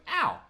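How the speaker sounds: short, high, loud "ow"; sudden acted cry of pain; mock pain yelp. Acts out being shot in game.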